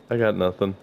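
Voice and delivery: ordinary talk, nothing marked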